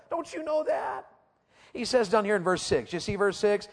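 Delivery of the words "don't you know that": emphatic high challenge. Acted.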